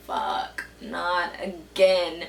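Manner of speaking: very low, drawn-out dread; dismayed groan. Retold or imitated. Girl realizing her pants are stained again, dismayed low groan. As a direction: sink low and slow in resignation.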